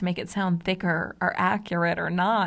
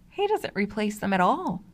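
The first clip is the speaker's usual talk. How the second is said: ordinary talk, nothing marked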